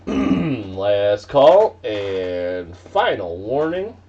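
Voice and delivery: drawn-out, slow final call; last call. Acted. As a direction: stretch each word, slow and loud. Auctioneer closing the lot.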